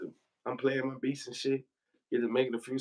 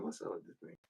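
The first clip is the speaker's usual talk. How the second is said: unvoiced, hushed question; whispered aside; 8 semitones lower, 16 dB quieter than usual. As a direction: breathe it under your breath, barely audible. Unsure aside among his partners.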